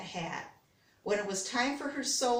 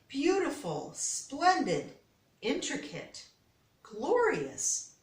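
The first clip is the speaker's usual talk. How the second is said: high, delighted exclamations one by one; admiring customers; 6 semitones higher, slower than usual. A narrator's reading. Customers admiring the eggs, delighted. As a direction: gush over each egg. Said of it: "said things like".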